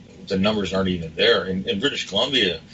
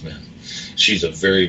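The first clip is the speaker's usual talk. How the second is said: ordinary talk, nothing marked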